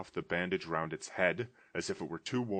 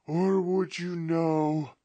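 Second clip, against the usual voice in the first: slow, high, loud mournful wail; ghost's voice; 11 semitones higher, 9 dB louder, slower than usual. Acted. Ghost lamenting its fate to Scrooge in a slow wail. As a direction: lament your doom from the grave.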